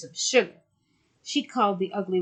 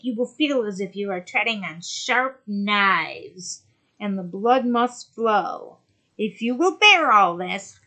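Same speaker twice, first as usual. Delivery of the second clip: loom over her as the sea witch; 5 semitones higher, 7 dB louder than usual. Acted. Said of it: "said the witch".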